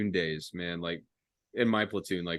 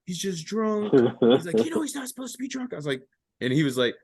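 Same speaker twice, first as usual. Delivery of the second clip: high put-on voices; 11 semitones higher than usual. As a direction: voice them high and thin. Buddy and sergeant voiced high.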